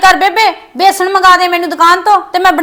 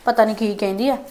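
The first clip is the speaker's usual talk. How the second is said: much lower and softer, under the breath; muttered aside; 8 semitones lower, 10 dB quieter than usual. Acted. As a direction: grumble so she can't hear. (ਹੌਲੀ ਜਿਹੀ ਬੁੜਬੁੜ)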